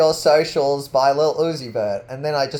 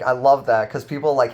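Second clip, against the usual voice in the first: normal talking voice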